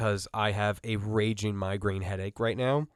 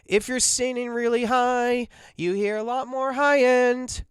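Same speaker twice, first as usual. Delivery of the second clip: much higher and louder to show high end; high voice demo; 12 semitones higher, 3 dB louder than usual. Shown as a demonstration. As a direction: pitch it way up to show the brightness. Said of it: "Do you hear how my".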